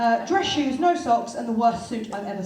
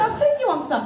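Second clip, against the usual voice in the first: excited fan; 12 semitones higher, 6 dB louder than usual. Retold or imitated. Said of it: "she went".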